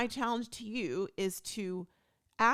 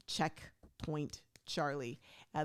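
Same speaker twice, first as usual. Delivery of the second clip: ordinary talk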